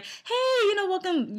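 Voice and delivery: very high, sing-out welcome; over-cheery greeting. Acted. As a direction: imitate an overly cheery restaurant greeter. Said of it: "everybody's not cheery like that".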